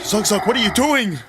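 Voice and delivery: high, bright-voiced companion asking; Pawn's voice. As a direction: call out to Zug Zug in character. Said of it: "As Pawn stands beside you and says that".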